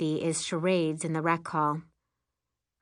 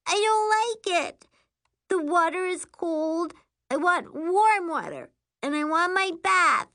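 put-on whiny little girl; 12 semitones higher, 5 dB louder than usual. Homesick little girl complains.

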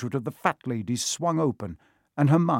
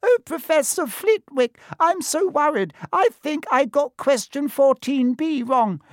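much higher, slow mock pleading voice; 5 dB louder than usual. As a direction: squeak it up high as a worried pupil. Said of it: "He put on a high voice".

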